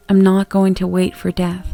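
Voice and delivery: ordinary delivery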